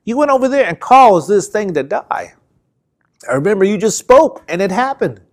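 high, excited retelling of amazed disciples; voicing astonished followers. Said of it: "disciples got all excited and said".

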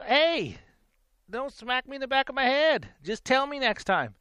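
pleading don't-hit-me complaint, pitched high; retold protest. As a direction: push back, pitched well up and indignant. Telling off the man who hit him.

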